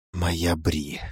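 low hushed whisper; whispering between kisses. Acted. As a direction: breathe her name softly. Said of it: "шептал он в перерывах между поцелуями". (шёпотом между поцелуями)

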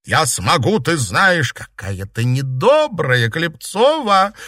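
high, drawn-out taunting; cocky reproach. A narrator's reading. Girl taunting her rival with hands on hips, cocky. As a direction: stretch the surname tauntingly. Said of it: "подбоченясь проговорила Серафима".

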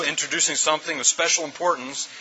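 normal talking voice

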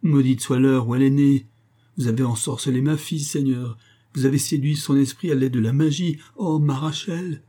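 high, impassioned cursing lament. A narrator's reading. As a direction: wail accusations like a distraught father. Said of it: "se lamenta le juif".

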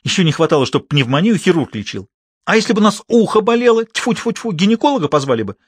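high, indignant scolding. A narrator's reading. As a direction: huff and complain at the doctor. Indignant sister scolds doctor.

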